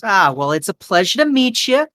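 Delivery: high woman's voice, chattering away; high-pitched, gossipy diner waitress; chatty waitress. Acted. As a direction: gush to a newcomer in a high voice. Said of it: "She goes".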